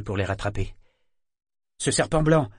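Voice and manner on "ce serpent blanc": high and louder, fear breaking through; abrupt, fearful question. A narrator's reading. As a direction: blurt it out with rising fear. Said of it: "d'une voix où perçait la crainte".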